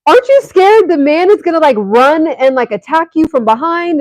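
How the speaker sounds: worried disbelief in her head; inner disbelief. Retold or imitated. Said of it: "I'm thinking".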